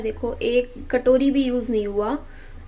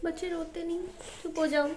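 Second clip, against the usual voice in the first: soft, higher coaxing hush; 5 semitones higher, 8 dB quieter than usual. Real, not acted. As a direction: gently coax the little one to stop crying. Mother comforting crying son. (रोते बच्चे को पुचकारते हुए, धीमी ऊँची आवाज़ में)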